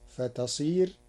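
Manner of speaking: usual speaking voice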